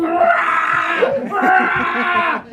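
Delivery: two high, imitated rah noises; imitated crowd roar. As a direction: roar like a riled-up crowd, played for laughs. Imitating women going crazy.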